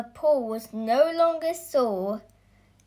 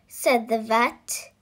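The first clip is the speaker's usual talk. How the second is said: usual speaking voice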